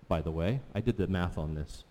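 ordinary talk

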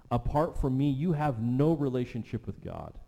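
building preaching. Acted. Preacher voicing Jesus to the congregation, building to a loud climax.

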